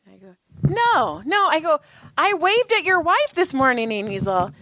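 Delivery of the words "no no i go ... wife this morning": high, incredulous protest; retold denial, pitched well up in disbelief. Retelling her denial to the dad.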